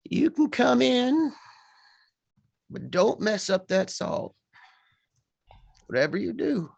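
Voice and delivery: put-on a frail old healer warns a visitor at her door; old healer woman. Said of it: "And she goes".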